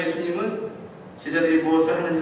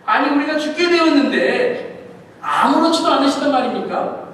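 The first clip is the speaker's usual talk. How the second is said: loud, high exasperated protest with a laugh; loud indignant "아무렇지도" with a laugh; indignant outburst; 7 semitones higher, 7 dB louder than usual. Acted. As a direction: throw the accusation back in disbelief. Indignant disciples confronting Jesus.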